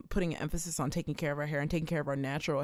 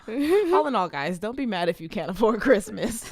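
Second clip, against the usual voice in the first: higher, louder, fighting laughter; laughing; 6 semitones higher, 6 dB louder than usual. Real, not acted. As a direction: barely get it out laughing. Cracks up mid-announcement.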